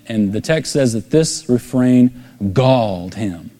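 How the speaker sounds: ordinary talk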